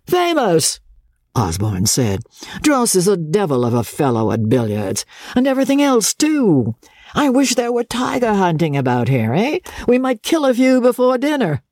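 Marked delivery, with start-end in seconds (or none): 0.1-0.8 mocking. A narrator's reading. Osborne answers mockingly. 2.6-11.6 teasing. A narrator's reading. Osborne teasing Joss.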